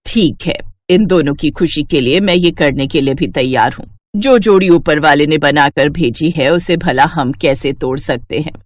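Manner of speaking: put-on a rich mother stunned by old words; Rajrani's voice. Rajrani giving in.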